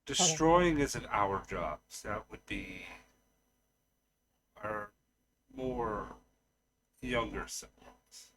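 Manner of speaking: soft, halting answer in character; hesitant Genesis. Acted. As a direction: explain it carefully, quietly, with long pauses. Game master plays Genesis.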